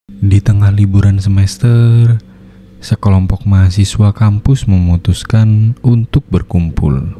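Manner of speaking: steady storyteller voice setting the scene; story narration. A narrator's reading. Narrator introducing four friends, calm storytelling. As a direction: open the tale like a radio drama narrator.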